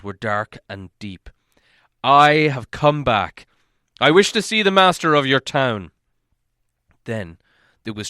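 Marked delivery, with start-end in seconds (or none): 2.0-5.9 stern dwarf king. A narrator's reading. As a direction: demand an audience like royalty. Thorin speaks to guards.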